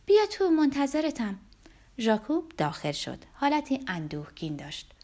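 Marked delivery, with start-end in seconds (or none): 0.1-1.4 louder and higher, calling out gladly; happy shout. A narrator's reading. Olga calls Jakub in through the door, a happy shout. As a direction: call him in with a delighted shout. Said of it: "با خوشحالی داد زد".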